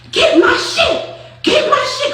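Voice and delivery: high, slow, frantic yelling of a furious friend; shrill demand. Retold or imitated. Noonie screaming at Flo for her stuff. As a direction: screech it out in a panic.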